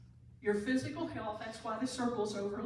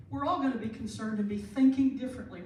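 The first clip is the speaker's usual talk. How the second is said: ordinary talk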